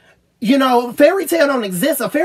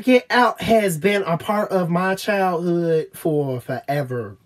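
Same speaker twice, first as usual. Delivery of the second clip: ordinary talk, nothing marked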